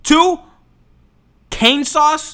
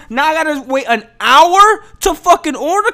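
ordinary delivery

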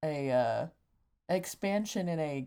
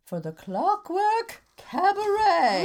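spooky announcer; 9 semitones higher, 5 dB louder than usual. Acted. Spooky show opening announcement.

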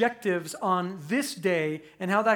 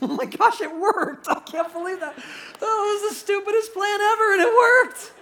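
much higher and louder, laughing in disbelief; can't believe it worked, rising to a laughing shout; excited disbelief; 11 semitones higher, 6 dB louder than usual. Retold or imitated. Israelites laugh and shout as their silly plan works. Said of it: "how excited everybody got".

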